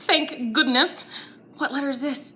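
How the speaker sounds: normal talking voice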